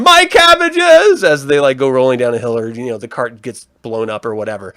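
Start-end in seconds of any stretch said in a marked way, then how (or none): 0.0-1.2 high, drawn-out comic wail; mock anguished yell. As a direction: cry out in hammed-up despair over lost goods. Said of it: "he's always yelling".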